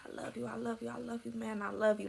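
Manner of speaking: normal talking voice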